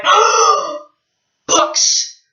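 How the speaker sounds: high gasp then breathy books; retold joyful gasp. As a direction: gasp over the books arriving. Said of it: "And I went".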